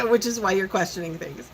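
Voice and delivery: ordinary delivery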